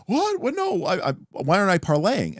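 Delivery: a sudden high-pitched What? of disbelief; mock indignant player, confused. Player baffled that the GM refuses the parlay roll. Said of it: "and the player's like".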